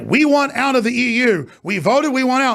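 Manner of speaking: high, emphatic imitation; retold insistent demand. Host imitating the lady from the EU Parliament, insistent demand.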